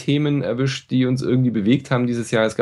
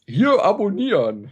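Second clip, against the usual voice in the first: YouTuber voice; 9 semitones higher, slower than usual. Pretend. YouTube sign-off call.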